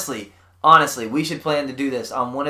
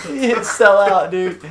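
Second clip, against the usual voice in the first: slow, higher, through a laugh; laughing tease; 4 semitones higher, much slower than usual. Real, not acted. Podcaster joking with friends about selling out, laughing. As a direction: tease it out slowly while laughing. Laughing at his own sellout joke.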